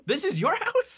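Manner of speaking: very high, rising squawked query; mock incredulous question. Imitating Clint querying a whispering ghost, mock incredulous. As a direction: question the ghost in exaggerated disbelief. Mocking player mishearing the ghost.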